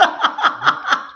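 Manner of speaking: airy laugh, no voice; high breathy laugh. Real, not acted. Laugh after line 81.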